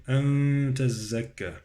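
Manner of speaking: "أن" hummed into "تزكى"; ikhfa of nun. Shown as a demonstration. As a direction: hold the veiled n of "أن" nasally. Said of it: "وإخفاء في النون".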